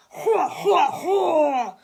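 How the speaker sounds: loud booming villain laugh. A narrator's reading. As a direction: laugh out loud like a gloating monster. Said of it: "laughed the bionic booger boy".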